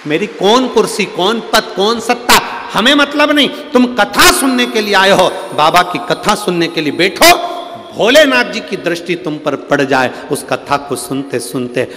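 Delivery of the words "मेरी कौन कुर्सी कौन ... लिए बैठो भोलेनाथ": high, loud, driving exhortation; forceful preaching. Real, not acted. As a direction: drive each demand home to the congregation. (ऊँची, ज़ोरदार आवाज़ में प्रवचन)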